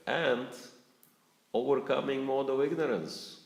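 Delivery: tickled by the irony of it; amused, laughing. Real, not acted. Amused at his own sleeplessness.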